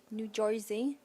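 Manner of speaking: "New Jersey" as "New Joizy"; New Jersey accent. Shown as a demonstration. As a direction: say "New Jersey" in thick Jersey twang, questioning. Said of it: "Can you do a New Jersey accent".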